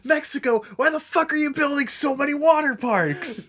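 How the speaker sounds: high-pitched comic demand; mock outrage. Imitating a South Park scene for laughs, mock outrage.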